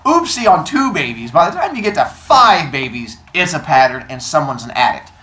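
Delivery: loud, emphatic telling-off; acted indignant scolding at full volume; upset. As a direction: play a fed-up wife laying out her case. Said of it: "So now Rhea is upset".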